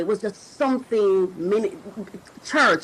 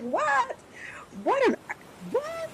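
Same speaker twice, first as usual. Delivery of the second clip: much higher, squeaky mock disbelief; imitating her own shocked reaction; disbelieving what; 5 dB quieter, slower than usual. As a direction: gasp it out like you can't believe it. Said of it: "And I'm just like".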